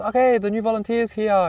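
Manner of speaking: high-pitched imitated call, not shouted; calling down to the house. Receptionist telling the household of the newcomer, imitated calling out. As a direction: announce an arrival to the house, lightly acted. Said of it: "calls down to the house".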